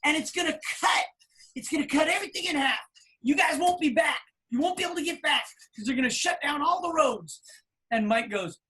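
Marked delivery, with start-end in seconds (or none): 0.0-7.4 alarmed warning. Retold or imitated. Manager warning the band the roads will close, alarmed. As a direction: push the danger hard.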